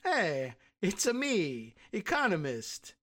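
a silly, lightly Italian "it's a me" voice; mock Italian accent. Host parodying the Italian economist, in a mock Italian accent. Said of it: "I don't know what kind of... accent".

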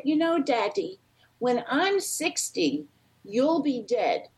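put-on high-pitched little girl, drawn out toward the end; sweet little girly voice. Five-year-old granddaughter telling her daddy, in a sweet girly voice. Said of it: "in her sweet little girly voice".

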